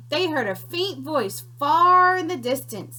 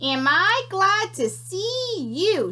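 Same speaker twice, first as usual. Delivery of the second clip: high, slow crackling creature voice; crackly creature; 7 semitones higher, slower than usual. Acted. Small sandwich creature greeting the piglet, high and crackly. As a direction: crackle it out with relish. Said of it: "she crackled".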